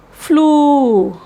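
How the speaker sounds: "flew" with the oo drawn out, falling; long U. Shown as a demonstration. Teacher contrasting example words, long U. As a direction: stretch the vowel of "flew". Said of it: "with a length U".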